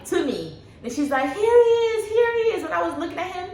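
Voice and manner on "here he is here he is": high, bright-voiced doctor announcing; imitating the doctor holding up the baby; excited doctor. Doctor shows newborn to mother, high and excited.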